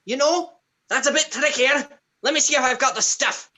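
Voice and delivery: high, playful Santa-style voice; jolly gift-giver. Acted. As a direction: ponder the request in a high character voice.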